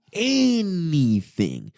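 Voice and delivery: "anything" stretched very long, starting high and falling; drawn-out emphasis. Real, not acted. Saying what one word could have done, "anything" hugely drawn out.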